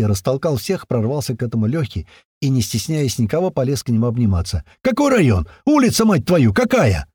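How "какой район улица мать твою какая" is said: high, eager shouted questions; excited yelling. A narrator's reading. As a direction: demand it in overjoyed excitement, pitched high. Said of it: "заорал Страйк откуда-то сзади".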